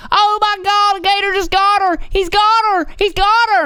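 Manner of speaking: shrill high mock panic of a neighbor; panicked neighbor. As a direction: squeal it like a hysterical caller. Panicked neighbor voiced in 911 retelling.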